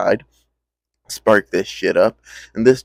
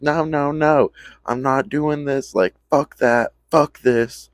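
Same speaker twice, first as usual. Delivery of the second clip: high, pleading friend voice; whiny refusal; 7 semitones higher, slower than usual. Retold or imitated. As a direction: whine it from behind the bathroom door. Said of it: "It's like".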